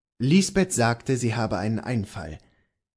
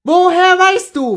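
wild questioning; 14 dB louder than usual. Acted. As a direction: demand it wildly of your husband. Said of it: "fragte jene wild".

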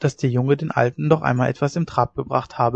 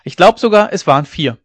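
higher and louder, indignant; blustering shout; 4 semitones higher, 7 dB louder than usual. A narrator's reading. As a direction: puff up and bellow the count. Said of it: "Bärenstimme".

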